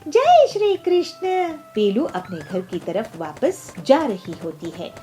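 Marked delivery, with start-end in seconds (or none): 0.1-1.3 high, loud exclamation; joyful devout hail. Acted. Peelu praising Krishna after the praise, high loud joy.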